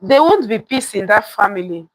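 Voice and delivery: plain reading voice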